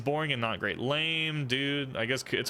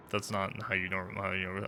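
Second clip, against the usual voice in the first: normal talking voice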